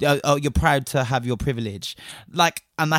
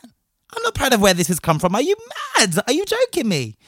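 incredulous outrage; 7 semitones higher than usual. Real, not acted. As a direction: pitch leaping up in disbelief. Outraged reply to privilege remark.

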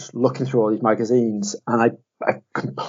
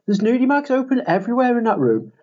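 put-on girl's voice; 12 semitones higher than usual. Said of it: "was like".

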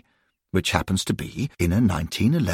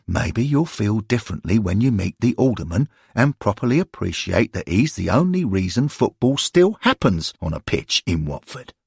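usual speaking voice

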